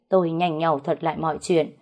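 plain reading voice

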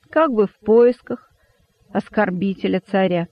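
ordinary delivery, nothing marked

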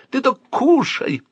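high, drawn-out urging; coaxing uncle. A narrator's reading. Uncle pushes porridge on the boy, coaxing. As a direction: slowly coax a child to eat.

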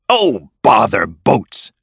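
slow, exasperated dismissal; angry. A narrator's reading. Toad cuts Rat off about boats, mildly angry. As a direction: drag out each word in annoyance. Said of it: "the Toad said angrily".

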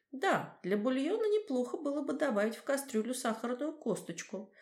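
high, connoisseur's advice; know-it-all. A narrator's reading. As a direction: advise like a seasoned expert. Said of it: "с видом завзятого знатока посоветовал". (знаток)

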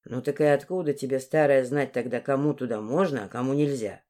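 low-pitched, insolent lad; cheeky young man. Acted. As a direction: drop the voice low and challenge the old woman. Narrator voices insolent Dmitry.

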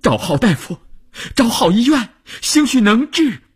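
high, climbing plea; desperate urging. Acted. Mother urges father to find doctors for their son, desperate and high. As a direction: urge it phrase by phrase, pitch rising.

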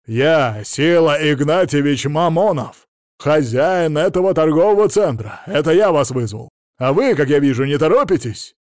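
loud, blustering announcement; full-voiced, offended boss; indignant self-important owner. A narrator's reading. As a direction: throw your weight around loudly. Angry owner scolds slow police.

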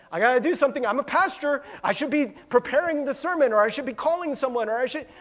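loud, high, rushed anxious inner voice; fretful pastor racing through his duties. Retold or imitated. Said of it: "It's the spirit of fear".

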